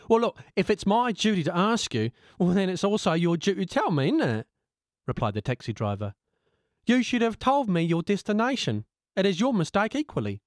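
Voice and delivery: a cabbie calmly arguing his case; reasoning taxi driver. Acted. Taxi driver argues back.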